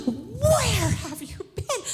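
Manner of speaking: high, drawn-out mock wail; hammed-up tearful demand, words stretched; crying. Mother finding her lost son, half crying, half scolding. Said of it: "I'm, like, crying".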